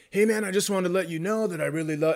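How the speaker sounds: fast, laid-back buddy voice; a friendly fast-talking comic; Willie's exact voice. Retold or imitated. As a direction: imitate the friend chatting up the cops. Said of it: "I am doing his exact voice".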